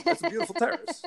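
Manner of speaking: high, teasing sing-song repeat of "That's a beautiful terrace"; mocking. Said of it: "everyone was like".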